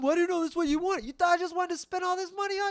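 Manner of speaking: very high, rushed protest; hammed-up quarrelling partner; defensive. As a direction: argue back fast in a high pitch. Acted quarrel, defensive partner answering.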